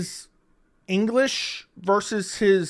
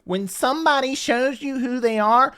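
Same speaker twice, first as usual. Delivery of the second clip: mocking Dana's line; 3 semitones higher than usual. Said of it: "why don't you put that quote up".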